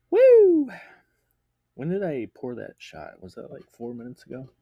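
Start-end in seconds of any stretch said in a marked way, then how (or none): 0.1-0.7 whooping. Real, not acted. After finishing shot.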